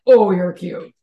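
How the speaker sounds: put-on loud, gushing coo at a little kid; acted cooing. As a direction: burst out adoringly, as if to a child. Reacting to children with accents.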